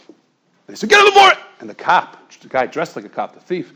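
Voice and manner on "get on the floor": shouted command. Retold or imitated. Police order at gunpoint.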